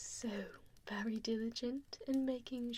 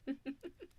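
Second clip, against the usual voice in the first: evil giggle; 3 semitones higher than usual. Acted. Villain gloating over captive.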